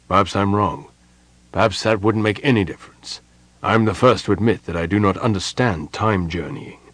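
usual speaking voice

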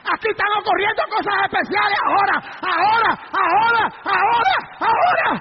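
rising from forceful telling to loud shouts; building fiery preaching. Real, not acted. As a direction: build to a shouted, repeated climax. (prédica que sube hasta el grito)

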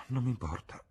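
high start falling away in a sigh. Acted. Young man shrugging off his lost friends, sighing. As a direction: sigh the words out, letting them drop. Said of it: "aggiunse con un sospiro".

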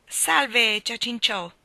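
loud, high, hearty greeting; loud jovial hail; cordial thunder. A narrator's reading. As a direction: boom a friendly hello that lands as an insult. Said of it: "tuonò cordialmente".

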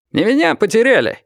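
high, challenging shout; calling out. A narrator's reading. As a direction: shout it across to them, pitched high. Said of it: "выкрикнул он".

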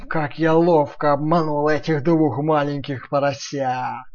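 slow gloating wolf. Acted. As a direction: gloat slowly, holding back laughter. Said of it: "чтобы не расхохотаться".

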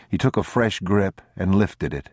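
plain reading voice

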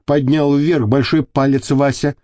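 plain reading voice

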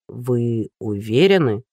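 low, slow, doubtful drawl; lingering, dubious question; drawn out. A narrator's reading. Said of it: "протянул Лебёткин".

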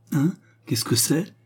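startled exclamation. A narrator's reading. Edward reacts to the cannon blast, startled. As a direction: ask it as if jolted. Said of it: "s'écria Edward Roxton".

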